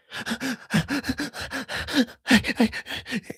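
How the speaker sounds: high-pitched imitation of uncontrolled sobs; mock sobbing. Driver imitates the sobbing girl in his car, mock sobbing. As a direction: sob in quick high bursts, hammed-up. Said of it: "Now I've got this sobbing girl".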